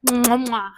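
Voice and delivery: kiss the air in praise; chef's kiss. Real, not acted.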